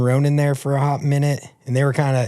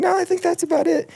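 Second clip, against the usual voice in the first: innocent lie. Retold or imitated. Lying to the recruiter.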